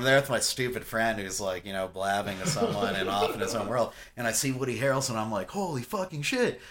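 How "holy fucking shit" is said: high-pitched, awestruck retold reaction; stunned, higher-pitched exclamation; amazed exclamation. Retelling his amazement at the star.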